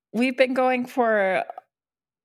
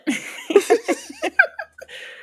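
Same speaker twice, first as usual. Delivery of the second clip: squeaky laugh, much higher; high burst of laughter; 3 dB louder than usual. Real, not acted. Laughing after no comment.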